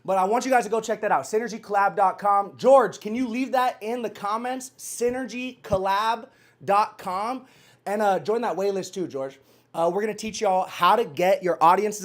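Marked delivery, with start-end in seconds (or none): 4.8-7.5 slow dictation. Real, not acted. Dictating a web address to George for the comments, slow dictation. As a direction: address spelled out word by word.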